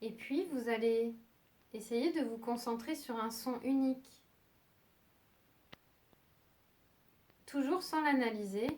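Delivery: soft, unhurried meditation voice with long pauses; calm yoga nidra guidance, leaving long silences; guided meditation. Acted. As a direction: guide them gently towards sleep, phrase by phrase.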